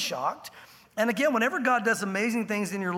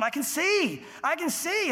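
joyful cry; 6 semitones higher, slower than usual. Retold or imitated. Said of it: "just going".

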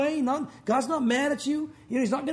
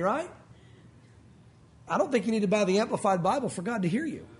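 normal talking voice

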